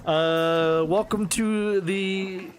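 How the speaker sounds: slow, halting put-on American accent; forced American accent. Shopkeeper insists he is American in a forced American accent. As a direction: stumble through it, straining to sound American. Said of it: "why are you talking like that".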